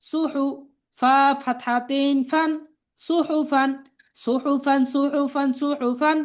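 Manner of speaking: level, measured chant with drawn-out letter names; spelling drill recitation. Shown as a demonstration. Teacher spelling out Arabic words for learners to repeat, chanted drill.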